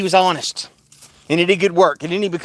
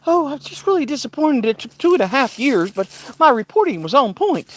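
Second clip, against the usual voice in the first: higher, prim imitation; light higher-voiced mockery of the reporter; 6 semitones higher than usual. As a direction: mimic her with a pitched-up voice. Catherine Herridge.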